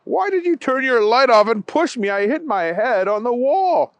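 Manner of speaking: complaining grandpa. Acted. Grandpa complaining after being pushed.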